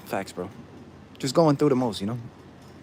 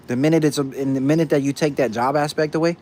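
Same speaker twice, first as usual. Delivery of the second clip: ordinary talk, nothing marked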